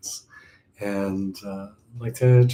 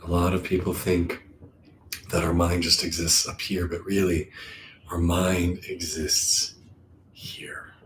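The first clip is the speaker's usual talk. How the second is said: calm meditation voice with long, settling pauses; guided meditation; 2 semitones lower than usual. Acted. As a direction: guide them inward, unhurried.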